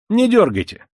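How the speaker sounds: high, loud warning; urgent shout. A narrator's reading. Young man to Koch tugging the door, high urgent shout. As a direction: call out to make him stop at once. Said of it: "закричал опять молодой человек".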